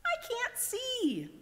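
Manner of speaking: very high, slow little snail. A narrator's reading. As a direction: squeak it out slowly. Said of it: "said the snail".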